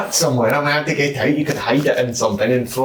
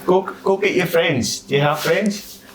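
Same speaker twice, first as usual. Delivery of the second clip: high cheery coaxing; 7 semitones higher than usual. Acted. Cheerfully urging the kenku child.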